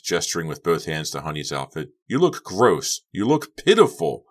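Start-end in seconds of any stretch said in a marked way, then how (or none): 2.1-4.2 loud, high angry jabs; scornful shouting, climbing in pitch; screamed insults. A narrator's reading. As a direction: fling each insult harder. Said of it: "Becca screamed".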